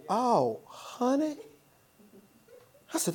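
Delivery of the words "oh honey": softer, tender "Oh, honey" rising at the end; soft answer. Retold or imitated. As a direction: show how gently he answered. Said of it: "A soft answer".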